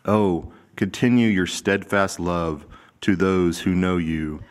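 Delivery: ordinary talk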